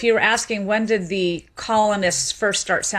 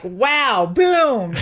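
amazed exclaim; 4 semitones higher, 4 dB louder than usual. Retold or imitated. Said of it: "and you're like".

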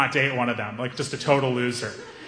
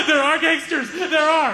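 high panicked cry; mock terrified squeal; 12 semitones higher than usual. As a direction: blurt it out in terror. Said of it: "Hamza is hyperventilating".

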